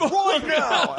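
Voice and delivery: high, drawn-out party yell; hammed-up rowdy fan yelling; rowdy bro shout. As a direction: holler it slowly like a drunk bro demanding shots. Voicing rowdy New York meetup fan.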